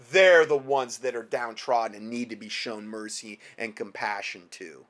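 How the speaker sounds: ordinary talk, nothing marked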